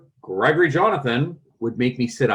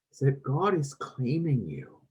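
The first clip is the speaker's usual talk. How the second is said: usual speaking voice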